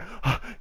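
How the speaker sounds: sharp unvoiced gasp for air; breathless gasp. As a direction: gasp like he ran all the way here. Out-of-breath guy at stop.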